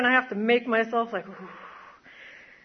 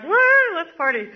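high whooping party call; gleeful mock party whoop; party cheer; 6 semitones higher, 4 dB louder than usual. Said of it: "I was like".

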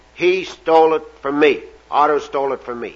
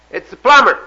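lightly voiced plumber, raised and louder; 7 semitones higher, 5 dB louder than usual. Plumber calling to whoever is inside, lightly raised call. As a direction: announce yourself through a door as the plumber. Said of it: "The voice came back and says".

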